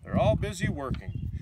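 lively, sing-song picture book reading; reading to children. A narrator's reading. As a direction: read it like a bedtime storybook for kids.

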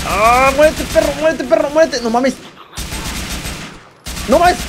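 high-pitched battle cry; frantic yelling. Real, not acted. Streamer telling a rival to die, frantic yelling. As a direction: yell it rapidly, voice pushed up high. Urging opponent to die mid-fight.

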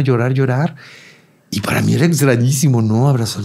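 usual speaking voice

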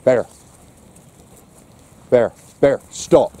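firm call to a misbehaving dog. Real, not acted.

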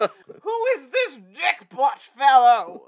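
slow, loud stuffy gentleman voice; posh gentleman voice. Pretend. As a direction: ask like a pompous toff. Mock posh puzzlement.

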